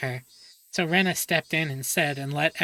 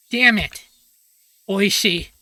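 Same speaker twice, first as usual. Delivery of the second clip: loud, higher outburst; furious shout; 4 semitones higher, 8 dB louder than usual. Acted. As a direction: spit it out in a rage. Mion furious at Oishi.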